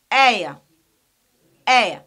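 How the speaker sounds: "aya" as a gliding AY plus short schwa; triphthong pronunciation. Shown as a demonstration. Teacher demonstrating how we pronounce it, "aya" as triphthong. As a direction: say "aya" loudly as one glide ending in schwa. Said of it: "We pronounce it as".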